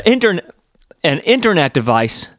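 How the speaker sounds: mock American keynote accent; Steve Jobs. Host retries the launch line, put-on American accent. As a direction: redo it as an American launch presenter. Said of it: "as Steve Jobs".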